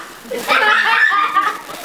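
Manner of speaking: a high bright laugh; high laugh at the story. Real, not acted. Teller laughs after quote.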